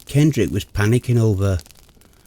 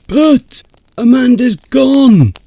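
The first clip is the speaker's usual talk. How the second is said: higher and louder, slow panicked cry; 11 semitones higher, 8 dB louder, slower than usual. Acted. Friend panics to narrator that Amanda is gone, alarmed cry. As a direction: blurt it out in alarm.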